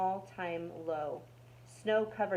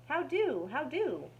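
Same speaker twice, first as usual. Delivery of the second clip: chirpy mouse; 8 semitones higher than usual. A narrator's reading. Mouse's chirpy repeated greeting.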